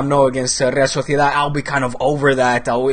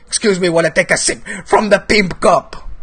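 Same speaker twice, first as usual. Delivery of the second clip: higher, playfully hammed-up aside with laughter in it; showy mock announcement; 5 semitones higher than usual. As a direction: announce it with comic relish. Showy announcement of his cup sip.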